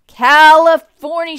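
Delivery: big loud cheer, pitched high; group shout. A narrator's reading. Passengers echoing the driver on arrival, loud shout. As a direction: holler it out, drawing out the first syllable. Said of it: "shouted everyone except for baby Betsy".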